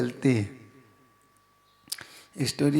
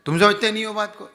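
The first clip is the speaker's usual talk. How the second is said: louder, higher mock scolding; demonstrated rude reproach; 4 semitones higher, 5 dB louder than usual. Shown as a demonstration. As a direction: act out a blunt, forceful telling-off. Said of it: "ये कहने से अच्छा है कि".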